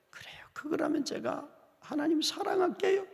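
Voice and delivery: soft weeping vow; tearful whisper. Retold or imitated. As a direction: confess love through tears. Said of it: "막 울면서 사랑을 고백하니까".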